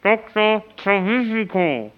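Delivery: mumbling round a mouthful of gauze; mouth full. Pretend. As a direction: slur the name through dental packing. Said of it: "Ich hab eine Tamponade im Mund".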